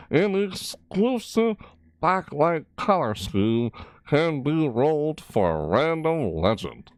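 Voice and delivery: announcer read. Acted. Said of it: "Yeah, I read like that".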